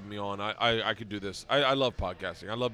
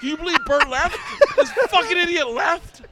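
very high, loud shriek of disbelief; hammed-up screaming outrage; incredulous yelling buddy; 11 dB louder than usual. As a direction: holler it at the top of the voice, high. Acts out buddy yelling on mountain.

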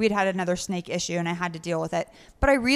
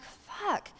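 muttered curse; 9 semitones higher, 10 dB quieter than usual. Retold or imitated. Retold muttered dismay.

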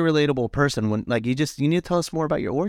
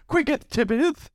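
put-on old English; 12 semitones higher, slower than usual. Shown as a demonstration. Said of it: "Now you have to say it in an old English accent".

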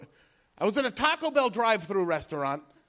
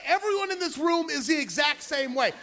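ordinary talk

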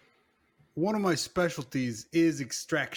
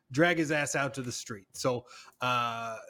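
ordinary talk, nothing marked

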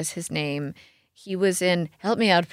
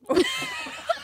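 high-pitched giggly burst; short burst of high laughter; 4 dB quieter than usual. Real, not acted. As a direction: laugh at your own joke. Joking about saying oui oui.

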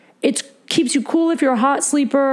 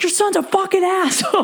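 higher-pitched mock yell at the boyfriend's dad; retold yell; 8 semitones higher than usual. Yelling at boyfriend's dad on speakerphone, a higher mock yell. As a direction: replay yelling the insult into the phone, pitch way up. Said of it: "I'm yelling this out".

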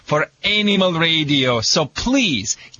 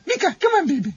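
very high-pitched coaxing call; calling the dog; 13 semitones higher, much faster than usual. Real, not acted. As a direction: sweet-talk the dog, way up high.

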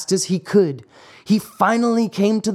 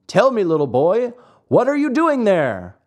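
higher, lilting call down to someone; 5 semitones higher than usual. A narrator's reading. Pigeon calling down to a weeping marionette, calling out. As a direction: call out a friendly question from above. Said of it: "he cried to him".

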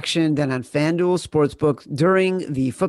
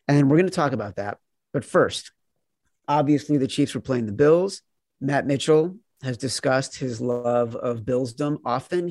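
ordinary talk, nothing marked

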